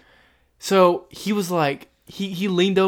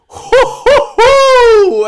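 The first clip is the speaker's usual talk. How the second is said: ecstatic falsetto hoo hoo hoo, loud; high euphoric hoots; 11 dB louder, much slower than usual. Retold or imitated. Morphine hitting the veins, loud high hoots. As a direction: let out giddy high-pitched hoots as the drug hits. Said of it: "I was just like".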